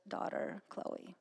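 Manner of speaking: ordinary talk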